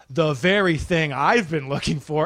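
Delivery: ordinary talk, nothing marked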